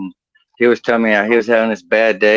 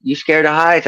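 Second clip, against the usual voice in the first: higher-pitched country boss calling out; 7 semitones higher than usual. Acted. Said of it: "my boss was redneck as hell".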